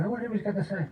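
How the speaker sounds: lightly acted listener straining to catch it; eager listener. Friend asking him to repeat it, eager curiosity. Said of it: "they'll go to their friends and go".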